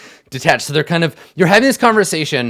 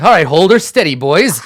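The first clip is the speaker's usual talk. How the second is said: louder, bracing call to the crew; 3 semitones higher, 7 dB louder than usual. Acted. Captain telling his boys to hold the boat before jumping, loud. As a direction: brace yourself and call it out.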